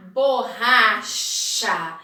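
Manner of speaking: Borracha stretched out very slowly; very slow recap. Shown as a demonstration. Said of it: "letters spelled".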